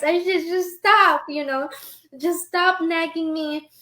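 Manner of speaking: usual speaking voice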